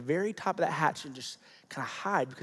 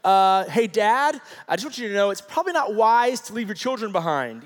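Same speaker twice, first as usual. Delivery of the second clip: put-on higher, louder parody of a child being reasonable; mock composed; 4 semitones higher, 8 dB louder than usual. Son advising his father, in mock composure. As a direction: pretend to advise politely, in a raised voice. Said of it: "gather his composure and be like".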